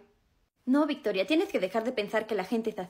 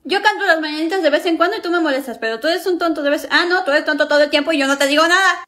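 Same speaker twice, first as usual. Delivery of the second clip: loud, higher retort; angry shouting back; 5 semitones higher, 9 dB louder than usual. Acted. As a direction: snap back at full volume. Sister shouting back in argument.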